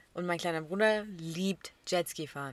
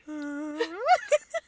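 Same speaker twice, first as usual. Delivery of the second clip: high, loud cry-laugh; laughing to tears; 9 semitones higher, 7 dB louder than usual. Real, not acted. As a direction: laugh so hard it almost sobs. Laughing at her own story. (Tränenlachen)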